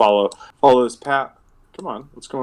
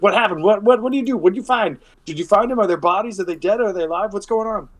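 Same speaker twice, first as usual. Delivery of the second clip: high, rapid-fire worried questions; fussing dwarves; 8 semitones higher, faster than usual. Acted. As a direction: pepper them with anxious questions. Dwarves pepper party with questions.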